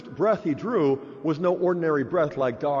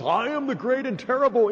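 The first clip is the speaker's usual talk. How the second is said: higher, booming mock pronouncement; Wizard of Oz; 7 semitones higher than usual. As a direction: ham up a grand wizard's declaration. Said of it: "God could have done a Wizard of Oz".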